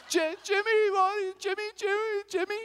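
high, sobbing mom voice calling the name; mom crying. Retold or imitated. Mother crying at the mic over her son, high and tearful. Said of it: "she starts crying".

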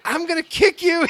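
high-pitched retold threat; mad. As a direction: imitate an exasperated, furious friend. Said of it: "He was so mad".

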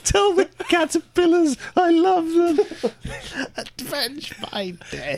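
high, fading dying voice; overacted death scene. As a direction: squeak out your last words. Butterfly's last words.

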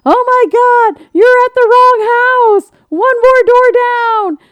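very high, loud panicked yell; frantic shout. Retold or imitated. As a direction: shriek it like a frantic friend. Friend's frantic texts voiced.

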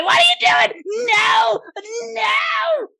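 high-pitched gnome yelling in alarm; drawn-out panicked squawks; tumbling gnome. Acted. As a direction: wail loudly as a little gnome falling downstairs. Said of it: "He's like".

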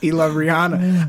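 ordinary talk

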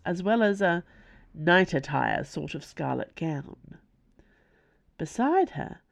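plain reading voice

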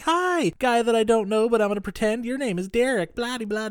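high, bright fake-sweet chatter; mock bubbly girl. Retelling Karen's greeting, high mocking sing-song. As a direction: chirp it like a ditzy brush-off. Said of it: "she's like".

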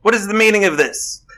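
loud high indignant yell; hollering. Acted. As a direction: bellow it out in outrage. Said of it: "I'm just gonna holler out".

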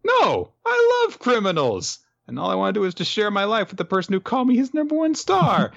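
high, hammed-up lovestruck gush; gushing girl. Captive girl to her kidnapper, mock adoring. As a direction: rush into it like an adoring fangirl. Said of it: "And she's like".

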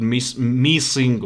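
usual speaking voice

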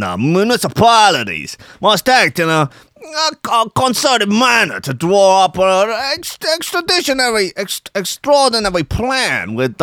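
bad American Italian accent, pitched high; stumbling, hammed-up American Italian mobster; Tony Soprano. Podcaster badly reading an official quote in American Italian accent. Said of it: "the worst Tony Soprano impression".

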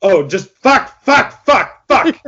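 high, loud panicked cursing; panicked swearing. Pretend. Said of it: "I've just been saying that solid for".

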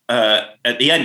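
ordinary delivery

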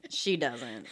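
dropped low and soft; a quiet, knowing repeat; quiet knowing aside. Real, not acted. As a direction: mutter it under your breath. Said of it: "repeated lower and softer".

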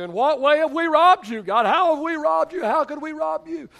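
high, loud mock protest; indignant protesters. People question God's accusation, high loud indignant protest. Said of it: "he anticipates our question".

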